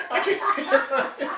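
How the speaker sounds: squeezed out between real laughs; laughing too hard to talk. Real, not acted. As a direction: gasp it through the laughter. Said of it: "laughing so hard you can't even talk".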